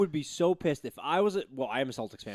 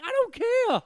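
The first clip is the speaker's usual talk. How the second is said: mock defiant yell; 4 dB louder, slower than usual. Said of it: "I'm like".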